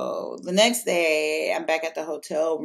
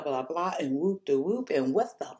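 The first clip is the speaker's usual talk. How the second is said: normal talking voice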